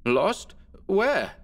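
sudden high rising alarm; alarmed question. Acted. Alonso to his cousin, startled and afraid. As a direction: snap up in startled fear.

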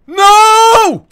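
high, loud yelp of shock; shocked scream. Real, not acted. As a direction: scream as you miss. Missed jump in game.

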